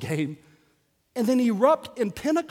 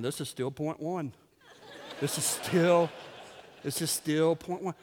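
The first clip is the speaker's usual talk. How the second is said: low, quiet disbelief; mock weary listener; 6 semitones lower, 10 dB quieter than usual. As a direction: grumble it privately, as if worn out by waiting. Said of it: "Some of you right now are thinking".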